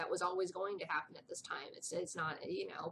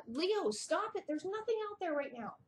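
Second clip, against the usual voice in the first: sharply higher scolding; scolding the dog; 10 semitones higher than usual. Real, not acted. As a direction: cut the dog off mid-bark. Telling her dog Leo off.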